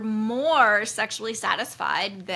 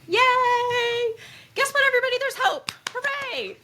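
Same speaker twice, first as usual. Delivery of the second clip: very high, squealed cheer; playful celebration; 5 dB louder than usual. Pretend. Mock celebration of the quiz answer.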